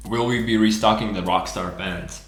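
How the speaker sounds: ordinary delivery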